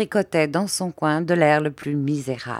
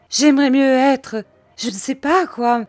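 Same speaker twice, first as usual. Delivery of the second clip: high, loud whining complaint; high plaintive wail of woe; self-pitying lament; 9 semitones higher, 6 dB louder than usual. A narrator's reading. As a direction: wallow in misery aloud. Widow laments.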